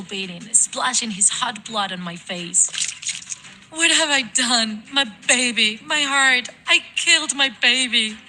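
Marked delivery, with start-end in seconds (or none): 3.7-8.2 loud, high-pitched horror and grief; distraught mother wailing in guilt; anguished cry. Acted. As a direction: cry out over your dying child. Mother has stabbed her own son.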